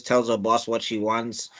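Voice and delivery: normal talking voice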